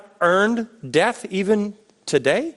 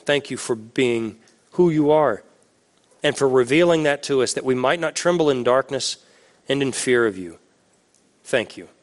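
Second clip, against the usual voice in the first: prayer; 6 semitones lower than usual. Acted. Pastor closing the sermon in prayer, hushed and lower.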